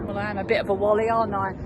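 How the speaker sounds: usual speaking voice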